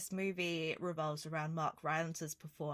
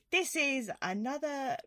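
slow, hammed-up British accent, higher pitch; generic British accent; 6 semitones higher than usual. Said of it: "It's weird that you did that accent".

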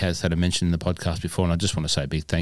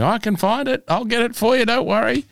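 very high, squeaky mock of the keen mate; eager helper voice. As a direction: chirp it like an overeager golf buddy. Mocking an eager playing partner.

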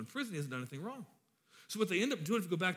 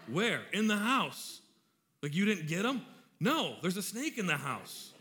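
excited alarm; 4 semitones higher, 5 dB louder than usual. Retold or imitated. Panicked phone call with wife.

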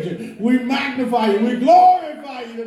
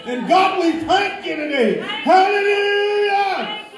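high, driving prayer cry; fervent prayer; 6 semitones higher than usual. Real, not acted. Praying to God as healer and way maker, fervent high cry. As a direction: build to a long, high Hallelujah. Prayer builds to full force.

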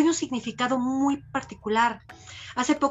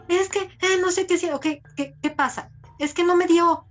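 voz de niño quejándose, más aguda; niño enojado; 6 semitones higher than usual. Retold or imitated. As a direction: imitando a un niño frustrado que no sabe explicarse. (niño que se queja de que no le dieron algo, enojado)

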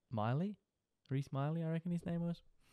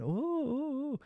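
very high teasing "ooh"; mock high "ooh" from classmates; much slower than usual. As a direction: squeal it like gossiping kids. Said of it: "whispering under their breath".

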